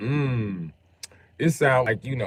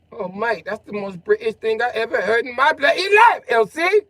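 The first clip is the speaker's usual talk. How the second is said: much higher, louder hammed-up British; mock British accent; 12 semitones higher, 8 dB louder than usual. Teasing his friend Elsie, a high loud mock British accent. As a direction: ham up a Cockney mate.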